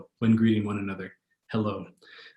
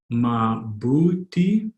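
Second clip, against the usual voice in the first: slow, separated syllables in "mabuti"; much slower than usual. Shown as a demonstration. Teacher modelling a Tagalog response, slowly by syllable. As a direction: sound out "mabuti" slowly for a learner.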